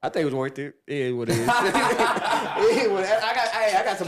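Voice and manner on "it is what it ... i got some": high, slow, laughed-out words; laughing. Real, not acted. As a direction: shrug it off laughing. Laughs while saying it.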